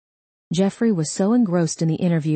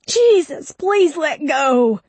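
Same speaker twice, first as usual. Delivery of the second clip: pained pleading; 10 semitones higher, 5 dB louder, slower than usual. Acted. Begging while in pain.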